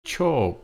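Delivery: Cho starts high and falls; falling tone. Shown as a demonstration. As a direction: let Cho drop from high. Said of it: "cho is falling".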